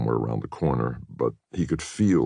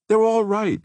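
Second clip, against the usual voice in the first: put-on much higher, louder nervous girl's voice; Katie, anxiously smoothing things over; nervous reassurance; 4 dB louder than usual. A narrator's reading. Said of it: "she kept saying".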